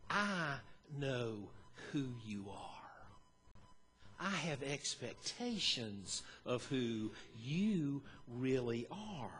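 soft, slow, near-whispered assurance; low and gentle, almost a whisper; Jesus telling his followers gently he knows who they are; tender reassurance. Shown as a demonstration. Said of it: "with an entirely different inflection in his voice".

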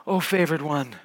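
low, soft voicing of an angel; a hushed, solemn angel; angel's greeting. Preacher voicing the angel greeting Mary, low and hushed. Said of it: "and he goes like this".

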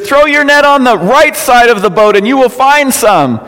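high, sustained call to a boat. Acted. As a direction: shout instructions across the water. Jesus calling out to the boat.

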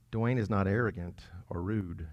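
low, soft and hesitant claim; sheepish, subdued self-assessment; doubtful self-test. Shown as a demonstration. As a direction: say it as if you barely believe it. Same verse with own name, doubtfully.

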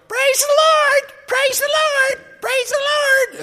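over-the-top high squeal of praise; hammed-up televangelist wife. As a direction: squeal it like a clown-suited fundraiser host. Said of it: "she would jump up and down and go".

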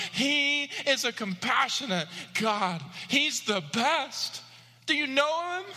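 fervent pulpit cry; full-force preaching. Acted. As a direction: lift them up with the Father's compassion.

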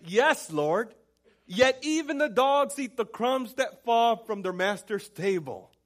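loud, high, slowed declaration; emphatic response. Acted. Woman answering Jesus in faith, loud drawn-out declaration. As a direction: proclaim her answer boldly, stretching it out. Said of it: "listen to how she responded".

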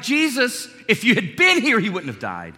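accusing outburst, high and loud, then dropping; blaming. Acted. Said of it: "She's blaming him".

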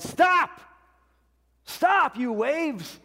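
high, loud commanding shout at the sea; king yelling. Retold or imitated. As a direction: bellow at the waves to stop. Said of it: "and he yelled".

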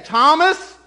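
high, loud, accusing call on the name; scolding. Retold or imitated. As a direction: call him out like a telling-off. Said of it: "and just scold him".

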